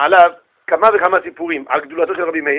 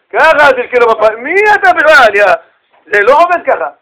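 loud rebuke rising high; who-do-you-think-you-are outrage; acted scolding protest; indignant students; 5 semitones higher, 5 dB louder than usual.

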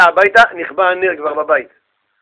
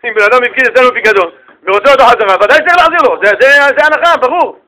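emphatic obvious ruling; 5 semitones higher, 5 dB louder than usual. Acted. Rabbi states obvious ruling.

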